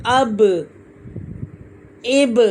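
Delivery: "अब, इब" with the b bouncing back; "अब, इब" released with a short rebound vowel; qalqalah bounce. Shown as a demonstration. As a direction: echo the b of "अब, इब" in a tiny "buh". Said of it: "लौट के आवाज़ वो ब वापस आती है".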